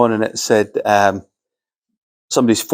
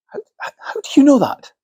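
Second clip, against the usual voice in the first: high, breathless whispered question; astonished whisper; 14 semitones higher than usual. Retold or imitated. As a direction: whisper it in disbelief.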